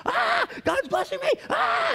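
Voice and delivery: thrilled churchgoer gushing about a blessing; gushing joy. Retold or imitated. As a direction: play an overjoyed fan sighing with delight. Voicing happy message senders.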